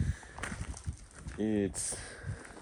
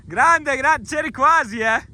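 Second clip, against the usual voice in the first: excited cheering; 5 dB louder than usual. Real, not acted. Cheering a near success.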